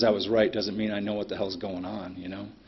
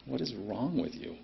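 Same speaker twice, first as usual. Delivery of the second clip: softer, baffled reproach; retold disapproving look; 8 dB quieter than usual. Onlookers silently judging the angry trucker, retold quiet disapproval. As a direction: quietly voice what onlookers are thinking. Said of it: "Other people look at me like".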